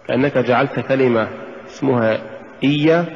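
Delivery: ordinary talk, nothing marked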